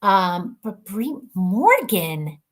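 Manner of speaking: ordinary talk, nothing marked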